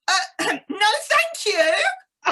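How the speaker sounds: high, loud, mock-offended no thanks; affronted refusal. Turning down the seniors group, high and affronted.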